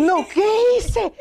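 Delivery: high slow frightened question; panicked. Retold or imitated. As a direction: drag it out in a panic. Repeating the panicked quote.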